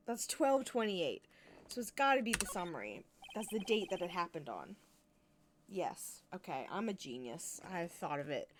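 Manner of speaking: ordinary talk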